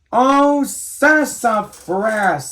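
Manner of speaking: high, loud, stretched cry; cried out. Acted. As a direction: wail it out long. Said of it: "cried everyone".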